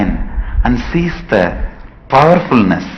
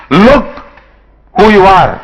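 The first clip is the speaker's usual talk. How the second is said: much higher and louder command; commanding lion; 8 semitones higher, 6 dB louder than usual. Acted. Lion pushing the cub to see himself, loud command. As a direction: order him to look.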